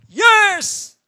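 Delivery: high, loud cry of yes; joyful exclamation. Real, not acted. Pastor exults to the congregation, joyful. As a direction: shout it in delight.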